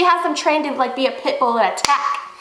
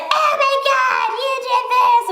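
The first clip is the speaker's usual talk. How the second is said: mocking, very high-pitched outraged screech; all pissed; 11 semitones higher than usual. Angry doctor to the driver who threw water, mock pissed-off yelling. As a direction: imitate her crazy yelling, stretched and high. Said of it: "she gets all pissed".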